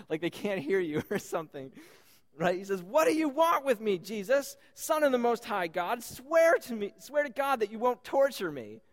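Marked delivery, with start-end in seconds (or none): 2.8-8.8 loud, raised-pitch pleading shout; acted desperate shout. Possessed man begging Jesus, shouting. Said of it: "He shouted at the top of his voice".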